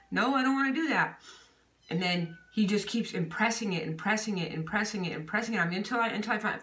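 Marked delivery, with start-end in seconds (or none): 0.1-1.2 higher-pitched mock protest; playfully whiny refusal. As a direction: dig your heels in, voice lifted. Acting out own refusal, pitch raised.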